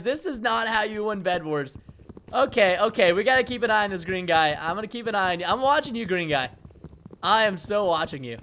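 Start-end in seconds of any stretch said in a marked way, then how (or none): none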